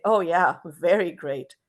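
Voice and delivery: drawn-out, falling mock agreement; sarcastic. Shown as a demonstration. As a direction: say it dripping with irony, not agreeing. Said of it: "I want to be sarcastic".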